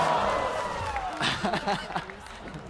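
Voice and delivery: very high-pitched burst of laughter; high squealing laugh. Real, not acted. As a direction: crack up at a pun. Cracking up at the pun.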